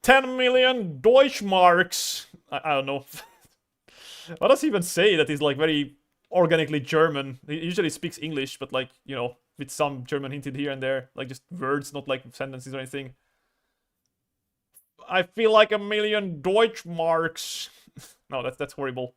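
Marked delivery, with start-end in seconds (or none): 0.0-2.3 put-on high, slow German accent; drawn-out German doctor accent; Medic German accent. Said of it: "do a T F two medic impression". 15.1-17.7 slow, higher hammed-up German accent; badly faked German doctor voice; Medic German accent. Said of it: "That's, that's a bad impression".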